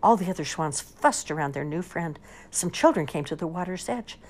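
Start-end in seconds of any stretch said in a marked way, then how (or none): none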